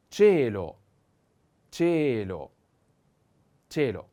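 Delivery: cielo said loud, stress on e; loud teaching repetition. Shown as a demonstration. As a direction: call out cielo for the class. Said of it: "the accent is on the e".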